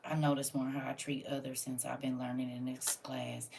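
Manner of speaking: normal talking voice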